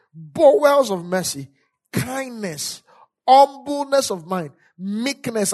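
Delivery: loud, drawn-out scripture recitation; slow, forceful teaching from the pulpit; emphatic preaching. Real, not acted. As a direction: call out each phrase for the congregation to repeat. Preaches scripture with call and response.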